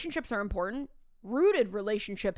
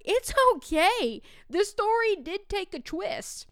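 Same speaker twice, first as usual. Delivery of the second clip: imitating a student, pitched way up; upbeat student reassurance; 10 semitones higher, 5 dB louder than usual. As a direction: brush off the apology brightly and loudly. Student reassures the mortified teacher.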